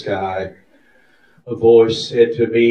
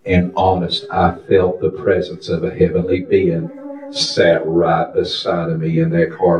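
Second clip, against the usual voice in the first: usual speaking voice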